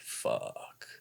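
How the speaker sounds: low, whispered curse; dismayed whisper. Retold or imitated. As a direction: curse under your breath in dismay. Retold dismay at being pulled over.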